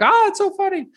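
very high-pitched, imitated delighted reaction; mock gushing amusement. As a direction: coo it way up high, like tickled judges. Imitating delighted audition staff reaction.

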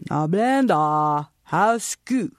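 put-on slow Chinese-accented Blenda, how's school; a grandpa's accent, slowly. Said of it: "conversation used to go something like this".

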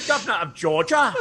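hammed-up Cockney take on a politician's line; mock Cockney accent. Co-host merging Harris with My Fair Lady, mock Cockney accent. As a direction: mimic the line in stage Cockney. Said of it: "a Cockney accent".

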